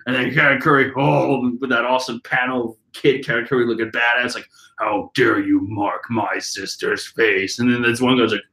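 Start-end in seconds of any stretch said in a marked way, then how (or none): 4.8-7.6 low, slow threat. Retold or imitated. Katakuri voiced threatening bullies.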